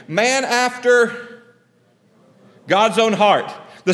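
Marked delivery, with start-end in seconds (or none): 0.1-1.4 slow, stretched prompt for the crowd; drawn-out prompt. Real, not acted. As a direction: draw it out so they finish it. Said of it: "finish the sentence for me".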